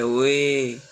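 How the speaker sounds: long loud drawn-out call ओए; stretched loud hail. Shown as a demonstration. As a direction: call someone from afar. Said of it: "जब हम चिल्लाते हुए किसी को बुलाते हैं".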